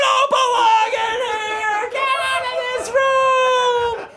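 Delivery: high, slow sung-out yell; mock yell. Owner scolding dog, slow sing-song mock yell. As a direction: drag the yell out, half sung. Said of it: "I'd flip him over".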